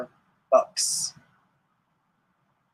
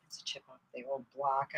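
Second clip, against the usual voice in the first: whispered aside; 3 semitones lower, 13 dB quieter than usual. Acted. Whispered aside watching son.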